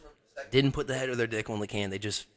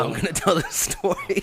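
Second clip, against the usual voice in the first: high, laughing announcement; giggly, raised-pitch defiance; laughing insistence; 8 semitones higher, 4 dB louder than usual. Real, not acted. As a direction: insist gleefully through laughter. Laughing over cohost's protest.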